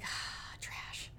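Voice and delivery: hushed, breathy "trash" of disgust; whispered disgust. Retold or imitated. Whispering her verdict on the cheating husband, disgusted. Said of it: "And I was like".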